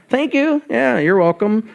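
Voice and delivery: high, bright doorman greeting to each visitor; cheery greeter. Retold or imitated. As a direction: chirp it like a friendly doorman. Greeting people at the front door.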